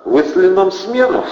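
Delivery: plain reading voice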